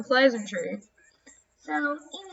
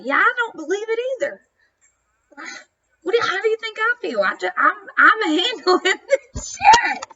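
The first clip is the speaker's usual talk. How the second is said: high, strained and half-laughing; laugh-crying through real disbelief; overwhelmed rant, voice pitched up and cracking; exasperated venting; 7 semitones higher, 4 dB louder than usual. Real, not acted. Venting about family chaos, laughing.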